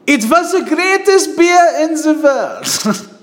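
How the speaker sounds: high, slow mock German accent. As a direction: ham up a German beer lover. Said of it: "The German accent's my favorite to imitate".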